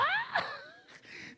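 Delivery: high-pitched burst of laughter; re-enacted guffaw. Preacher re-enacts his helpless laughter in church, high guffaw. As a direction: break into a high acted-out laugh. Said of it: "e gargalhava".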